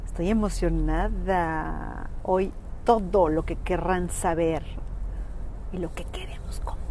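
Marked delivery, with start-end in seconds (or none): 5.7-6.8 hushed secret whisper. Real, not acted. Teasing show secrets.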